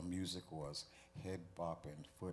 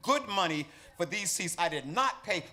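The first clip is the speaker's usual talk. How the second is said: much higher, much louder indignant yelling; outraged audience shout; 13 semitones higher, 15 dB louder than usual. Acted. Concertgoers shouting about the noisy child, high and furious. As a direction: yell your complaint over the noise. Angry audience members complain loudly.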